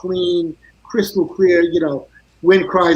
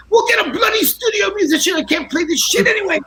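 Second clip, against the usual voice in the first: Jack's angry shout; 13 semitones higher than usual. Retold or imitated. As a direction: shout it like a furious bassist throwing his gear. Jack's outburst retold.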